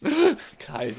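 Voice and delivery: ordinary talk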